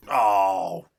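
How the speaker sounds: loud drawn-out cry of dismay; anguished cry. Real, not acted. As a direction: wail it like you just lost. Sudden loss in game.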